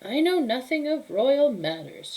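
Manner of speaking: put-on high, brusque old lady voice; dismissive old woman. Befana brushing off the kings, high curt old woman. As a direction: brush him off like a cranky crone.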